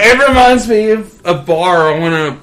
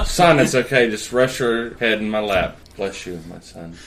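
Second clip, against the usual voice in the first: priest voice; 8 semitones lower, 7 dB quieter than usual. Pretend. Host plays a soothing priest.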